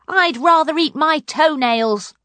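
high, loud defiant princess; furious. A narrator's reading. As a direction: refuse in outraged disgust. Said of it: "Princess Poppy was furious".

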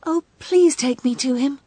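put-on a young girl hoping for news; eager little girl. Gerda character speaks.

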